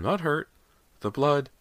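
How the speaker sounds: high-pitched, halting insistence; rattled mother. Acted. Mother explaining the blood to her family, rattled. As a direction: pitch it high, in short shocked bursts.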